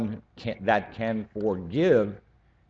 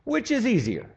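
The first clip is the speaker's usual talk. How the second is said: much higher and louder, pressing urgent build; impassioned preaching; 11 semitones higher, 4 dB louder than usual. Real, not acted. Preacher stirring the congregation, high and fervent. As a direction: preach it with rising fire.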